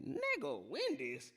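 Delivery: high-pitched mock disbelief; incredulous retort. Telling Tempo off over his aunt's Wendy's job, incredulous. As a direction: retell it as a scoffing, incredulous comeback.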